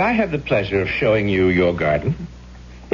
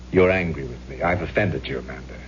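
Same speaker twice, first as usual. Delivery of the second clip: normal talking voice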